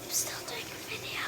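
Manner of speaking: hushed aside so the video isn't disturbed; whispering under the recording. Real, not acted. Whispering to Kara that the video is still running.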